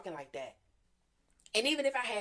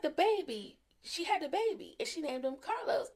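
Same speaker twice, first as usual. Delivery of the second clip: much higher, emphatic retelling of the daughter; insistent excited; 9 semitones higher than usual. Retold or imitated. Middle daughter insisting to her mom, excited and high. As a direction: pitch it way up and insist.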